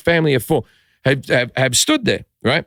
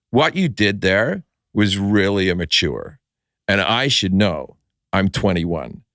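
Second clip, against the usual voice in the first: low, slow, measured reproach; condescending scolding; 7 semitones lower than usual. Retold or imitated. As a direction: talk down to him like the mature one. Young woman scolding him, acted.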